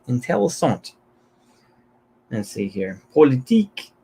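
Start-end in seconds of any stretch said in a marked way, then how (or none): 0.1-0.9 Intéressant with a higher, hammed-up French lilt; mock French accent. Shown as a demonstration. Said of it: "I'm not gonna try to read this in French". 3.1-3.9 French accent. Shown as a demonstration. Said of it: "my French, as you can hear, is not very good".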